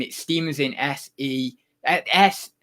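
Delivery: ordinary talk, nothing marked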